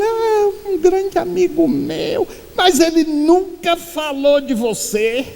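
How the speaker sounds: high, slow, earnest reply; the mistaken mourner answers the widow, high and defensive; flustered reply. Retold or imitated. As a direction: insist he was a great friend.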